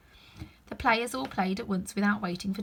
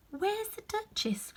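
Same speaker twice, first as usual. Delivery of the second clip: slow, high, hushed question; curious Alice; 12 semitones higher, 3 dB quieter, slower than usual. Acted. Alice quietly asking the rabbit, high and slow. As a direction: ask it softly.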